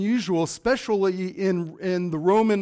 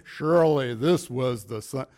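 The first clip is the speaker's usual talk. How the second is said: John Wayne; 6 semitones lower than usual. Acted. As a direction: play a miscast movie centurion, pitched well down. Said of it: "in my John Wayne voice".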